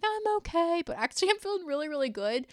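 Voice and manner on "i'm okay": downplaying. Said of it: "That's downplaying my happiness".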